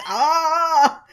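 high, loud, long mock yell; long "aah" of someone running away; fleeing yell. Embarrassed man runs away yelling, mock scream. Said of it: "runs the fuck away while yelling".

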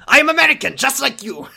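hammed-up outrage at the misdiagnosis; high, hammy indignant doctor voice; mock furious doctor. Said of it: "He looked furiously".

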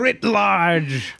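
theatrical, lightly put-on villain; mock villain. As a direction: proclaim it like a comic-book baddie. Acting a villain bit.

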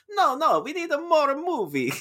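high, laughing mock demand; mock producers. Studio bosses demanding a longer cut, high laughing mock. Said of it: "they're like".

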